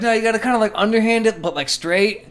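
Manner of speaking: high, loud know-it-all coaching; high eager instructing voice; coaching friends. Retold or imitated. Coaching friends on throwing eggs.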